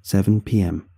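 ordinary delivery

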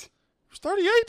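high, drawn-out incredulous question; astonished. Real, not acted. Learning the actor was thirty-eight, astonished. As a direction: let your pitch leap up in disbelief.

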